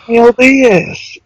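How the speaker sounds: drawn-out, high-pitched hail; mock calling out. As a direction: call over a coworker, stretching the name. Calling out to coworker in skit.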